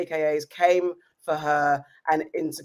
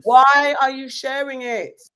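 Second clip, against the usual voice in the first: loud, slow, high outraged shout; 8 semitones higher, 6 dB louder, slower than usual. Real, not acted. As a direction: yell the question in disbelief, slowly. Echoing outrage at shared video.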